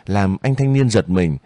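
plain reading voice

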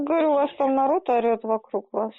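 usual speaking voice

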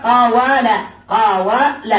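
قاوال said syllable by syllable as qa-wa-la; underlying form spelled out. Shown as a demonstration. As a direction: sound out قاوال with the w clearly voiced. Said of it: "أصلها". (تقطيع قاوال إلى قَ وَ لَ)

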